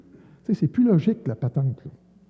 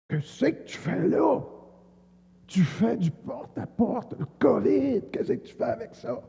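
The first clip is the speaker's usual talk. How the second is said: much higher, slow, hammed-up shout of a big bearded man; mock angry yell; 9 semitones higher, 3 dB quieter, slower than usual. Bearded homeowner yells at the door-knocking preacher, hammed-up and high. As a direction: shout it like an irate giant at his door. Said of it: "il crie après moi".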